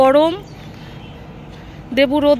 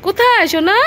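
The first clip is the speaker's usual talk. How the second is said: higher, sing-song call to the dog; pet talk; 6 semitones higher, 3 dB louder than usual. Real, not acted. Calling to the dog.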